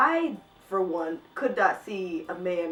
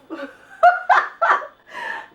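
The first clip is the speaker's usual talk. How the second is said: shrieking laugh, much higher and louder; high burst of laughter; 11 dB louder than usual. Real, not acted. Vlogger cracking up at a bad sex scene, shrieking laugh.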